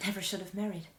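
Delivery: hushed, regretful aside; quiet regret. Acted. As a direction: confess it softly to yourself. Regretful repeat, first take softer.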